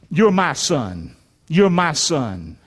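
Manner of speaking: low, slow fatherly affirmation; tender father. Acted. God the Father speaking to Jesus, low and tender. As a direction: say it slowly, letting it sink in. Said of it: "what was God the Father saying to, to Jesus".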